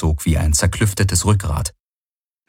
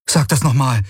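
astonished; 5 semitones higher than usual. A narrator's reading. Kirai astonished.

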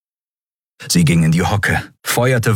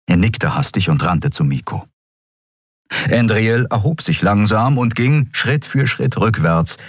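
ordinary delivery, nothing marked

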